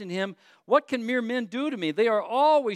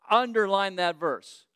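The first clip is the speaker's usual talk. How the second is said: ordinary delivery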